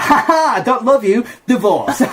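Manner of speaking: high, loud triumphant ha-ha; hammed-up victorious taunt; mock gloating. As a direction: gloat like you've pulled off revenge, laughing. Imagined triumphant comeback to heckler.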